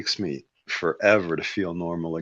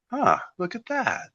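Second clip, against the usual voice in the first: imitating a dazed self, much higher and odd-voiced; dazed realization. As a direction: play it like you just noticed the obvious. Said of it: "And I was like".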